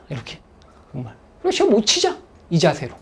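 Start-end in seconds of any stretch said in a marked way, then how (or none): 1.4-2.2 higher, breezy shrug of a line; cheerful giving up; carefree resignation. Shown as a demonstration. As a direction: let it go lightly. Acting out the give-up mindset.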